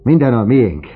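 loud, high-pitched cry of joy; elated, voice leaping up; exultant. A narrator's reading. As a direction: proclaim it jubilantly. Overjoyed father exults.

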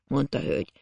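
plain reading voice

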